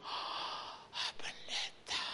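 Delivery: weak, voiceless gasp; imitated helpless patient. Sick man greeting the pastor from hospital, feeble whispered gasp. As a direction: breathe it out with no strength left. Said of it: "bapaknya nggak berdaya".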